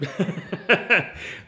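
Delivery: loud, high burst of laughter. Real, not acted. Preacher amused that sinners won't admit it, laughing. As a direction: laugh out loud mid-sermon.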